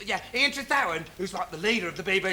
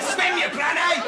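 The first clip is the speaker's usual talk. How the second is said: louder, higher jeering yell; mock shout; 7 semitones higher, 6 dB louder, slower than usual. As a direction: shout it from the bank. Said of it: "they just shout".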